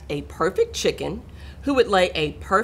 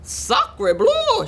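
very high, theatrical duck voice; excited duck cry. A narrator's reading. Duck spots the nest, crying out excitedly. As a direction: exclaim it high as an astonished French-speaking duck. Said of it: "she cried".

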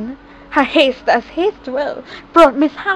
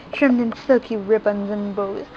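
plain reading voice

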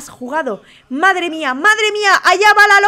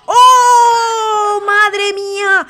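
sudden high, loud cry of shock; startled shout; 6 semitones higher, 5 dB louder, much slower than usual. Real, not acted. Commentator sees the tower collapse, shouts in shock. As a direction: yelp as the tower falls.

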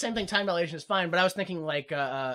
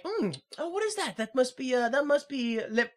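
higher-pitched musing taster; Carl savoring a drink; 5 semitones higher, 3 dB quieter than usual. Retold or imitated. Carl guessing the drink after a sip, musing. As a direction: muse over a sip in a high voice.

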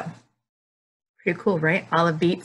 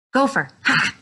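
playful fake start, louder; joking fake-out; 6 semitones higher, 4 dB louder than usual. Teacher teasing kids with a false start, playful. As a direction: trick them instead of saying go. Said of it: "Just joking".

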